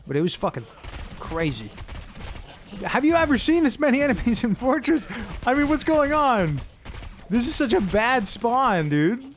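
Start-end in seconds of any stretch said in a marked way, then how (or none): 2.9-9.2 excited. Real, not acted. Huge enemy spawn in game.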